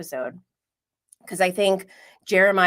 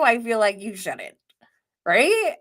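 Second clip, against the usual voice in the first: ordinary delivery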